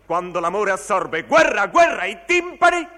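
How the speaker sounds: rising from loud to a very high, full-throated yell; building to a shout. Acted. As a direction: crescendo into a war cry. Recited war clamour building up. (crescendo fino al grido acuto)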